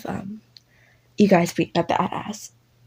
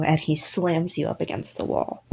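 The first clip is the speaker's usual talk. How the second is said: ordinary delivery, nothing marked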